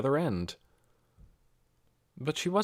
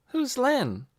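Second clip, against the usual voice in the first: put-on higher young girl asking; lightly voiced girl, puzzled; a touch of a curious girl; girl's voice; 13 semitones higher than usual. A narrator's reading. Nikki voiced in story.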